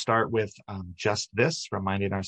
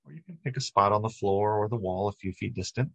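ordinary delivery

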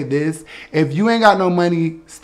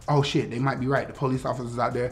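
softer, fast scared inner thought; inner panic; 6 semitones lower, 11 dB quieter, faster than usual. Retold or imitated. A kid fears her mom, quiet inner panic. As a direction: mutter it to yourself in a hurry. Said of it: "So I'm thinking".

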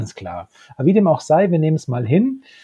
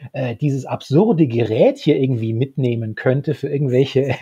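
ordinary delivery

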